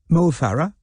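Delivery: genuine surprise, rising high; surprised. Acted. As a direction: caught off guard by the name. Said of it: "in unaffected surprise".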